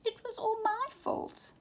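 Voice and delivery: put-on high guilty child voice; high small-child voice, upset; little elephant. A narrator's reading. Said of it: "cried Dumbo".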